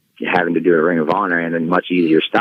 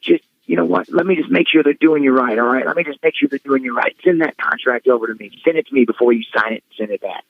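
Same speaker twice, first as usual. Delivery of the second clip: put-on higher, pushy old-timer voice; Cornette impression; 6 semitones higher than usual. Cornette telling Tyler to send him the contract, higher put-on voice. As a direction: insist on it in a high put-on voice. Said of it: "he's like".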